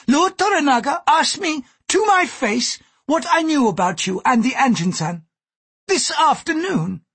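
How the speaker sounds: put-on high-pitched, emphatic older-world courtesan voice; indignant Gyoko. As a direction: protest vehemently in a high character voice.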